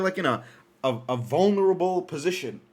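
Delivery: ordinary talk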